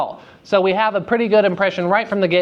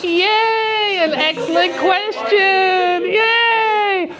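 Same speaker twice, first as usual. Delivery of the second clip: excited cheer; 14 semitones higher, much slower than usual. Real, not acted. Teacher cheers a student's question.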